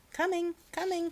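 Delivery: cheerful high call. Acted. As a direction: call it toward the door. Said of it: "called Amelia Bedelia".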